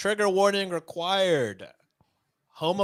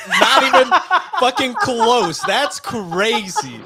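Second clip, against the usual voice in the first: laughing disbelief; 7 semitones higher, 6 dB louder, slower than usual. Real, not acted.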